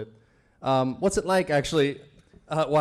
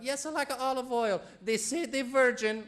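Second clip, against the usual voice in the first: Italian mom's advice; 8 semitones higher, 5 dB quieter than usual. Retold or imitated. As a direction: lecture like an old Italian mama. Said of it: "my Italian mom gave me".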